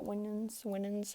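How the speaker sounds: Winans said as WIN-ans, soft; Winans pronunciation. Shown as a demonstration. Showing listeners how she says Winans, softly. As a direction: say Winans with a short i. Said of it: "say it like that".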